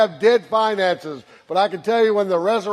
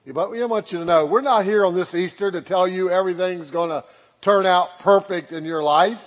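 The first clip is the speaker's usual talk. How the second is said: ordinary talk, nothing marked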